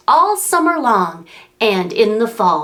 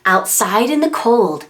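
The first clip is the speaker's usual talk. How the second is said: plain reading voice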